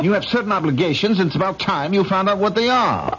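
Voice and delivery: high-pitched, heated reproach; angry lecturing. Acted. Husband reminding his wife of obligations, angry high lecture. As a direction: lay down the law, pitch kept high.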